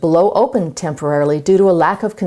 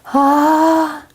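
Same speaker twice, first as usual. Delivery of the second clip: breathy, weak sung ah, higher; airy weak ah; 9 semitones higher than usual. Shown as a demonstration. Demo for students of an airy, weak sung tone. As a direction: let the air escape on a thin ah. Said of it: "the sound is airy, breathy, and weak".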